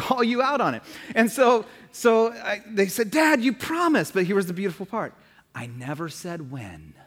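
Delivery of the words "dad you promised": high-pitched imitation of a child's complaint; complaining kid. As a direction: plead like a kid reminding Dad. Acts the kids' complaint.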